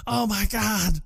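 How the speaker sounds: high-pitched mock wail; hammed-up anguish at a funeral; crying. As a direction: cry out in overdone grief. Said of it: "Chad's crying".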